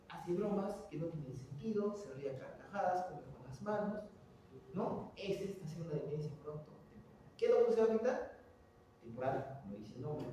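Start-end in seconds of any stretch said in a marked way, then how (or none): none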